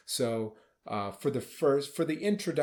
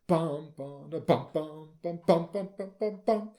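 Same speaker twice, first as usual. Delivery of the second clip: rhythmic bum-bum syllables, climbing higher and louder; sung-out accent demo; 5 semitones higher, 4 dB louder than usual. Shown as a demonstration. Conductor showing players what not to do, heavy vocalized accents. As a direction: imitate a phrase with a thumping downbeat accent. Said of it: "to wanna accent beat one each time".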